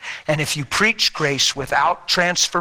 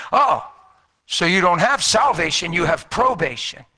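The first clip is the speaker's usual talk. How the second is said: high, emphatic mimicked retort; mocking gotcha; 4 semitones higher, 3 dB louder than usual. Man hitting the preacher with his punchline, mocking. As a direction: pounce with the punchline, pitched up high.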